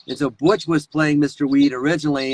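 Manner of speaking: ordinary talk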